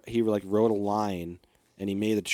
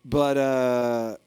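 ordinary delivery

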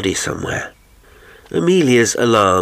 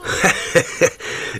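high, jovial character chuckle; merry burst of laughter; 10 semitones higher than usual. A narrator's reading. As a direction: laugh out heartily, pitched high. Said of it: "He laughed out jovially".

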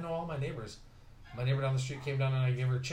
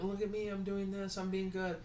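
higher sarcastic put-on voice; mocking show-off voice; 7 semitones higher, 3 dB quieter than usual. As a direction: parody people posing for the camera. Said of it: "A lot of these people are like".

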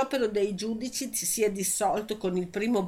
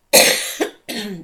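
chesty cough; 11 dB louder than usual. Real, not acted. Newsreader with a cold coughs.

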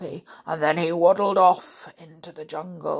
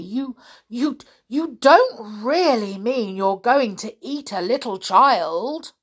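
higher, stammering, aghast; loud, high-pitched dismay; horrified disbelief; 5 semitones higher, 6 dB louder than usual. Acted. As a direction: stumble in, then plead in shock. Horrified at child-eating plan.

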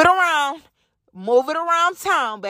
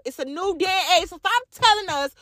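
ordinary delivery

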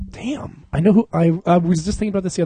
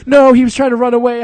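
defensive mom; 9 semitones higher, 6 dB louder than usual. Retold or imitated. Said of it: "She was like".

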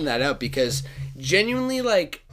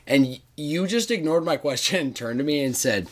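ordinary talk, nothing marked

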